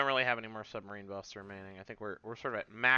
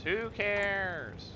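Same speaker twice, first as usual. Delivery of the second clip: Who cares? flung out higher and louder, cares dropping; mock dismissive; 9 semitones higher, 4 dB louder than usual. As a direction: toss off Who cares? with a theatrical shrug. Repeats shrug line louder, higher.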